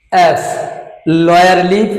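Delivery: ordinary delivery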